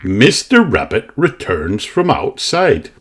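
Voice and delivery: plain reading voice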